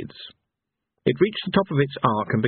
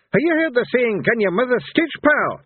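high-pitched, raised-voice taunt; yelling; 13 semitones higher, 3 dB louder than usual. A narrator's reading. Mad Hamish answers Fate's saying with his own, yelling. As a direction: yell it out high and loud. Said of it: "he yelled".

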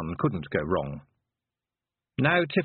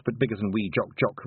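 ordinary delivery, nothing marked

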